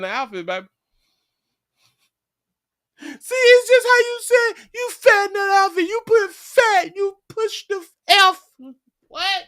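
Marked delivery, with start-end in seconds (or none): 3.0-9.4 very high, loud scolding woman's voice; mock offended woman. Wife scolding her man over how he said it, shrill and loud.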